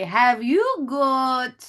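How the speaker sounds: high, drawn-out mock British accent; little British accents. Tutor mimicking her pupils, exaggerated British accent. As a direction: stretch it out like British-accented kids. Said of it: "they have these little British accents".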